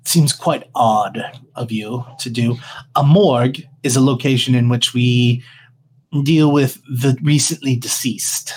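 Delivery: a cold undertaker, unbothered; tiefling mortician voice. Acted.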